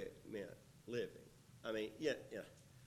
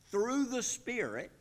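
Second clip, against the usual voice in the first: "through the Spirit" much higher and louder; stress on Spirit; 11 semitones higher, 7 dB louder than usual. Shown as a demonstration. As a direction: lift "through the Spirit" high and loud. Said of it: "emphasize that".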